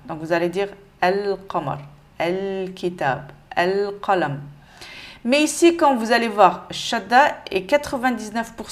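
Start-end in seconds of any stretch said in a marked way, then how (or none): 1.0-4.4 clearly sounded L in "al-qamar, al-kitab, al-qalam"; lam prononcé. Shown as a demonstration. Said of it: "le prononcer normal". (« al-qamar, al-kitab, al-qalam », L bien prononcé)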